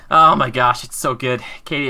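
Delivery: ordinary delivery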